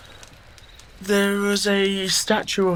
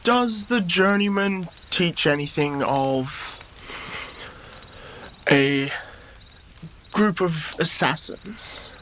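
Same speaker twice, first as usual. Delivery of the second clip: slow, careful question with long pauses; hesitant; slower than usual. Acted. Charlie carefully asks Baptiste about assassins, slow and hesitant. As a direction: feel your way to the awkward word. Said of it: "I don't know how to put this".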